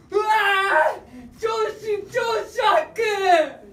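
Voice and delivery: high, loud comic wail of shock, the first cry drawn out slowly. Acted. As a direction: wail like the world just ended. Comic realisation that his boss is Japanese. (realizing his boss is Japanese, a loud, high, hammed-up wail)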